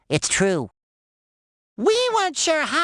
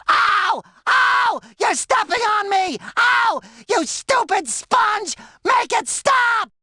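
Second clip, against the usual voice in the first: imitated screaming; 11 semitones higher, slower than usual. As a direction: shriek it like someone being stepped on. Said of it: "I could hear him screaming".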